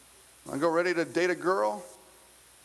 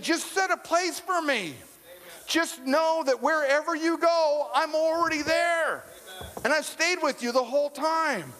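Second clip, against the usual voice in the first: voice of God; 8 semitones higher than usual. Retold or imitated. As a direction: speak as God, lifted and unhurried. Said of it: "He said".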